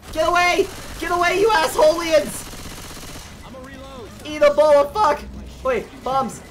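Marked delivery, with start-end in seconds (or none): 0.1-5.2 loud, high furious shouting; angry yelling. Real, not acted. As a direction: yell at the zombies. Fighting off zombies, yelling.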